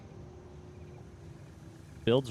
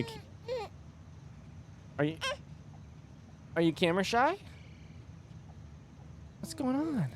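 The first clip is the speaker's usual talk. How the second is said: very high, sing-song cooing; 13 semitones higher, 7 dB quieter than usual. Real, not acted. Cooing to the baby on camera.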